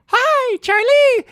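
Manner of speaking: very high, loud squeaky greeting; friendly car bush. Retold or imitated. Talking bush greets driver's son, friendly squeaky voice. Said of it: "we drive by and I go".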